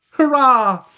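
high, loud hurrah; delighted cheer. Acted. Boys accepting the bargain, loud delighted cheer. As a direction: cheer with the pitch right up.

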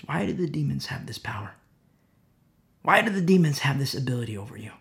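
normal talking voice

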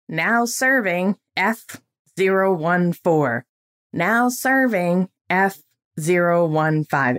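slow, measured number-calling voice; DMV announcement. Retold or imitated. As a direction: imitate an automated queue call, drawing out each item. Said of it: "that is what they say".

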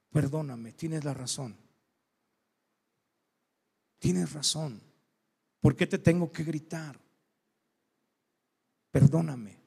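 low, quiet and contrite; hushed, sincere admission of fault, with pauses; soft apology. Retold or imitated. Father admitting to his daughter he was wrong, gently apologetic.

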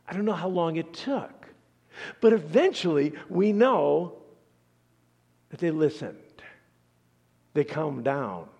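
ordinary talk, nothing marked

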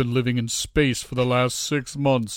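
slow, put-on spaceman voice; lightly hammed-up Vader; mock Darth Vader. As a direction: drag it out like a masked villain. Mocking Vader's breathing voice.

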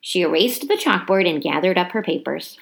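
ordinary delivery, nothing marked

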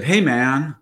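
a hint of Valley Girl in a retold quote; Valley Girl accent. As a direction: imitate a Valley Girl, casually. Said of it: "got into a Valley Girl accent".

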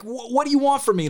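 high-pitched exasperated protest; imitated anger. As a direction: mimic someone fed up with being asked. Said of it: "he was angry".

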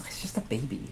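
hushed, breathy whisper; a muttered whisper aside. Retold or imitated. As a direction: drop to a whisper, dismissing it. Dismissive aside to co-host.

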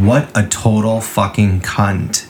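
usual speaking voice

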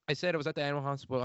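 ordinary talk, nothing marked

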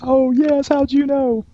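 put-on high, small child's voice, eager and proud; little girl voice. Joke-telling host voicing a first-grade girl, high and eager. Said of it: "a little girl came up".

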